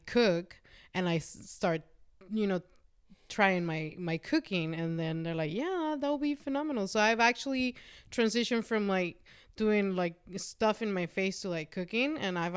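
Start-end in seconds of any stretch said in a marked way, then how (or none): 5.5-6.9 high, enthusiastic retold reply; eager approval. Customers agreeing to a cooking video, eager approval. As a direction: imitate a keen customer, pitch lifted throughout.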